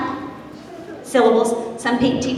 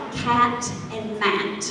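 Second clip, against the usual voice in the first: slow rhyme demo; 3 semitones lower, 7 dB quieter, much slower than usual. Shown as a demonstration. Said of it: "we're going to do".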